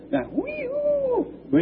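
high falsetto elk call; imitated elk bugle, very high. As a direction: whistle it up like a bugling elk. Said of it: "I can hear the elk".

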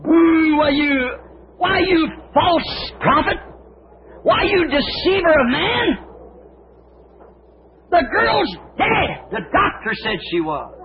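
high, crying, jeering shout; mocking crowd booing. The crowd boos Jesus as a false prophet, high jeering shouts. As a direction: boo and taunt the false prophet. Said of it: "they booed him".

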